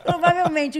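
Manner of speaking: laughing out the word twice. Real, not acted. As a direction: say it while laughing. Laughing answer about mother.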